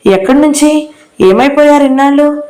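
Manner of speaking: delighted to hear him after so long; surprised. A narrator's reading. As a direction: pitch jumps up in glad surprise. Medha surprised by uncle's call.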